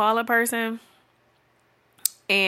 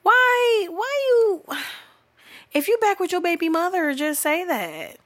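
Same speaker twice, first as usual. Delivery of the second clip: very high, squealed complaint; squeaky comic exasperation; exasperated outburst; 9 semitones higher than usual. Acted. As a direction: splutter it high, at wit's end. Exasperated address to absent man.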